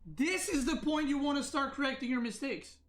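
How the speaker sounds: high, heated, incredulous retort; retold angry retort. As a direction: throw his words back at him in disbelief. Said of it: "And I say".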